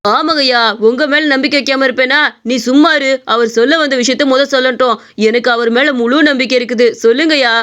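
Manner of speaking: ordinary talk, nothing marked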